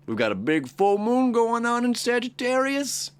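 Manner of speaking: high, bright sing-song announcement; excited. Real, not acted. Astrologer hyping the full moon to viewers, high and excited. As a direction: ride the thrill of the full moon.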